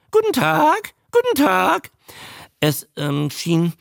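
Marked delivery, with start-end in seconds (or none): 0.1-1.9 high squawked greeting, rising; high-pitched creature mimic; newt repeating. A narrator's reading. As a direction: parrot it back in newt voice. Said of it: "wiederholte der Molch".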